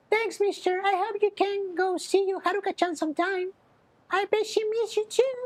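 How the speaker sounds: put-on very high little kid voice; child voice. Child thanking Kiryu, very high kid voice. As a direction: squeak it out like a small child.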